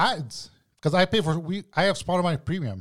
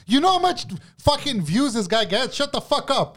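high, indignant acted rant; mock angry telling-off; 9 semitones higher than usual. Pretend higher-up scolding complaining Spotify employees, mock angry. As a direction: play a fed-up boss shutting staff down.

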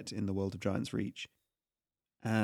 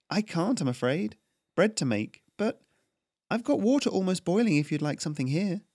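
put-on higher, anxious young woman giving in; young baker Melora; 8 semitones higher than usual. Baker Melora hurriedly agreeing to pay the tax collector. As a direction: placate him quickly.

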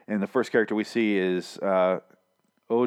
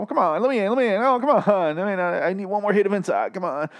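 political junkie begging, high and hammed-up; Gregory; 5 semitones higher than usual. Gregory begging for a taste of inside, mock junkie pleading. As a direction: plead like an addict needing a fix, mock desperate. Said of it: "he feels like a political junkie".

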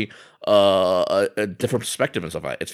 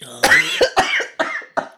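hacking cough. Pretend. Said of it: "my voice is busted".

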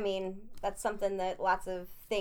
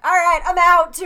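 exasperated exclaim; 7 semitones higher, 15 dB louder than usual. Retold or imitated. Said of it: "I was like".

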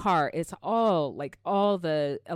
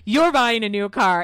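loud, higher mock amazement; all-caps exclamation; 5 semitones higher, 7 dB louder than usual. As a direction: voice his text in capital letters. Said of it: "You see the bigger letters".

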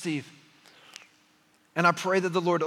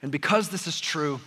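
ordinary talk

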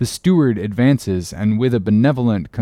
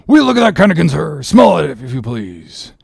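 indignant sailor; 4 semitones higher, 4 dB louder than usual. Acted. Stubb complains to Starbuck.

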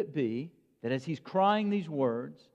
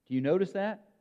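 ordinary talk, nothing marked

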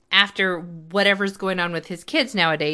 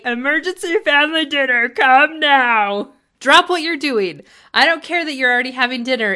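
mock bossy parent, higher and louder; 5 semitones higher, 6 dB louder than usual. Mocking the parents summoning their kids, bossy and loud. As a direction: imitate demanding parents, fast and loud. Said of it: "and say".

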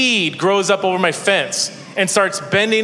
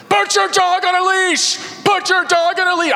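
neighbor screaming; 13 semitones higher than usual. Retold or imitated. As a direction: bellow the order across the yard. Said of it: "screaming at my wife and children".

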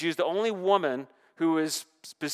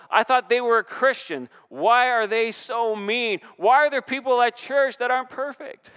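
mock indignant churchgoer; 7 semitones higher, 7 dB louder than usual. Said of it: "I'll hear sometimes from people".

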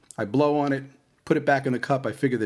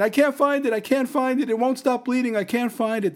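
imitating the wife, even more panicked; 13 semitones higher than usual. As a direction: push it up into near-hysteria. Wife repeats panicked phone words.

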